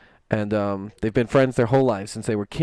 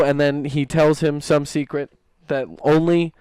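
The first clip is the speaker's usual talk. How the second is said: usual speaking voice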